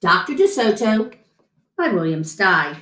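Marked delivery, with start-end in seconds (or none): none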